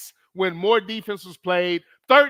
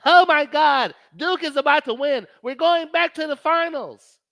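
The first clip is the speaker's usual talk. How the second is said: hammed-up excitement, much higher and louder; mock Duke fan glee; 9 semitones higher, 4 dB louder than usual. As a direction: gloat like a fan sure of the win. Mock Duke fan celebrating early.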